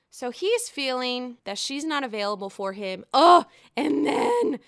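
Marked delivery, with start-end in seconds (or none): 3.1-3.4 disgusted groan. Real, not acted. Disgust at Ray's remark.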